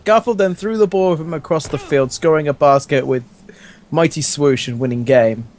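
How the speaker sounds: ordinary talk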